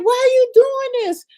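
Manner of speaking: high, loud, wounded voice; mock hurt woman, pitched high and loud; imitating her hurt cry. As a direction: squeal it like she can't believe it. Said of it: "She's like".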